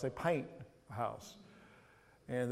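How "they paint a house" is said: house drawn out Tennessee-style, much softer; Tennessee drawl on house. Retold or imitated. Pastor mimicking a Knoxville client's house, drawn out and soft. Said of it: "my Knoxville, Tennessee clients".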